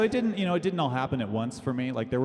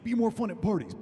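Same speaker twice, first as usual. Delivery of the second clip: high mock parent yell; hammed-up parent shouting at a kid; mock angry parent; 9 semitones higher than usual. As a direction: sneer it like a cruel dad. Imitated abusive parent.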